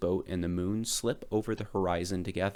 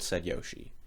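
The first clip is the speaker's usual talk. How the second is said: plain reading voice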